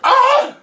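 sharp high yelp of pain. Real, not acted. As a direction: yelp as the heat hits. Hot sauce hits.

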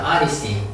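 Honesty with the H dropped, starting on the vowel; Honesty, H left silent. Shown as a demonstration. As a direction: say Honesty as "onesty", no H sound. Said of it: "H를 발음하지 않습니다".

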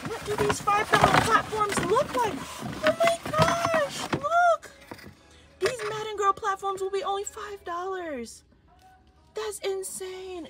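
very high, squealing disbelief; heart-pounding excitement. Real, not acted. Shopper discovering five dollar platforms, ecstatic. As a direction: let the pitch soar into a thrilled squeal. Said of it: "my heart is pounding because".

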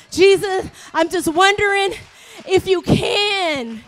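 pleading that swells, then slows on the final words; unsure, desperate plea. Acted. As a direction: blurt it out as a risky last hope. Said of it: "He was so unsure, like".

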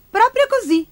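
put-on high-pitched child's voice; high boyish storybook reply; little boy. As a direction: answer brightly as a small boy, pitched well up. Boy answers the shopkeeper.

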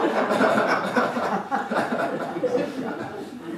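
airy high laughter. Real, not acted. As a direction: laugh loud. Teller laughing at story.

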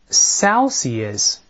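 "Celsius" repeated clearly, SEL-see-us; model reading. Shown as a demonstration. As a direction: repeat "Celsius" slowly for students. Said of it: "再来一遍". (再示范一遍"Celsius")

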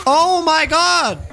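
high, drawn-out wail of outrage; exasperated outburst. Real, not acted. Player cursing a teammate, exasperated high wail.

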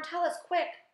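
high, loud, impatient plea; desperate earthworm's cry. A narrator's reading. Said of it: "cried the earthworm".

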